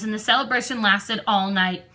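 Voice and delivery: plain reading voice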